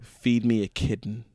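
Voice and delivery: low, slow, quiet machine message; ATM message. Retold or imitated. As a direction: read it out slowly, low and soft. Voicing the ATM's message.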